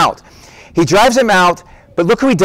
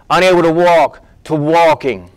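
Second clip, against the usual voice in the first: normal talking voice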